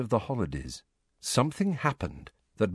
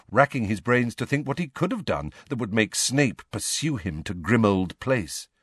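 ordinary delivery

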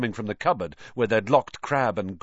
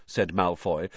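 ordinary delivery

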